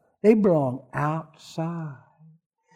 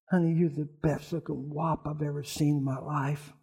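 much softer, lower, romantic murmur; tender soft line; 4 semitones lower, 11 dB quieter than usual. Retold or imitated. To his date over candlelight dinner, soft romantic delivery. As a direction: lean in and say it softly like a sweet nothing.

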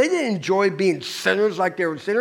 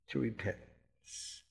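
soft echo; 9 semitones lower, 19 dB quieter, slower than usual. Real, not acted. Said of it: "repeats softly".

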